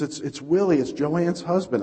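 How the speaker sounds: high, urgent retold stammer; frantic caller, acted at moderate volume; imitated panic. Church lady breaking news to pastor, imitated panic. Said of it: "she is just really panicking".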